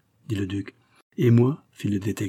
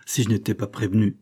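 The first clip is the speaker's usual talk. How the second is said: ordinary delivery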